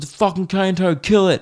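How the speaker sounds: a hammed-up commenter urging a kill; an annoyed follower demanding he kill the toad, lightly voiced; mock insistent follower.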